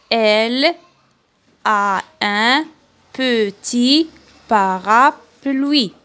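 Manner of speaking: teacher reading the text aloud; slow model reading. Shown as a demonstration. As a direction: read loud and slow, word by word, for pupils. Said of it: "à haute voix le texte".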